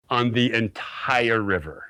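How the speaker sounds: usual speaking voice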